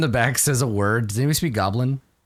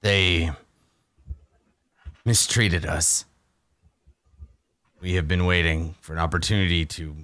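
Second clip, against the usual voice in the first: weary hobgoblin; 4 semitones lower, 4 dB quieter than usual. Acted. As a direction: sigh it out like a beaten prisoner.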